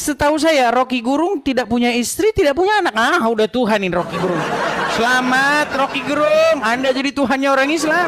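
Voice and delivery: high, laughing mock congratulation; mock him through laughter; laughing mockery. Real, not acted. Mocking joke about Rocky Gerung. (mengejek sambil tertawa)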